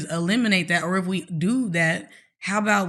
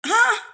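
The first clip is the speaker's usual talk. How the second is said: very high, disbelieving laugh; incredulous laugh; 13 semitones higher than usual. Real, not acted.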